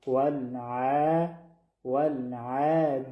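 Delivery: ولع and والعادیات chanted with the long "aa" held; drawn-out tajweed demonstration of والعادیات; two-count madd. Shown as a demonstration. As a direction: stretch the vowel after the ayn in والعادیات.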